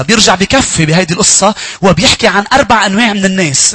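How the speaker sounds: loud, high fervent sermon voice; preaching at full force. Acted. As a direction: proclaim it to the whole church. Preacher proclaims to congregation.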